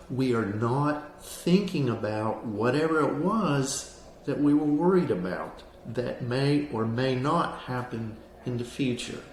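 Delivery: usual speaking voice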